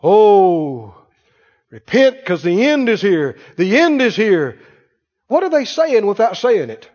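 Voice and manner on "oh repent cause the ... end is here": mock prophetic warning with a long moaned "Oh"; imitated doom-preacher tone. Mimicking people preaching and prophesying, doom-laden warning. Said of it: "you hear that tone in their voice".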